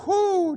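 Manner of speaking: high, drawn-out stuffed whoo; satisfied whoo. Retold or imitated. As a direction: let out a full-bellied whoo. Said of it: "everybody's sitting back going".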